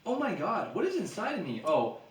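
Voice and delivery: high, loud fake alarm; mock startled woman. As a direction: ham up the shocked victim. Voicing the stuck woman.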